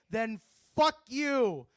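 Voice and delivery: loud, slow, punched; punchline shout. Real, not acted. Comic signing off to the crowd with a loud, slow shout. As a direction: hammer the last words.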